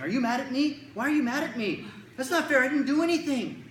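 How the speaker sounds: high, loud mock-upset protest; frantic texting kid. Kid texting a silent friend, escalating mock outrage. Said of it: "So they start texting".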